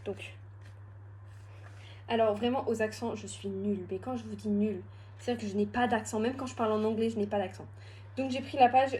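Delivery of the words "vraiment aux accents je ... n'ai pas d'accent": ordinary talk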